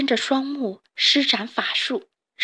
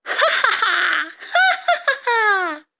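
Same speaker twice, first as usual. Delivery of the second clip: much higher, slowed manic laugh; wild laughter; 11 semitones higher, slower than usual. Acted. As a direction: cackle with crazed glee. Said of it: "兴奋地狂笑".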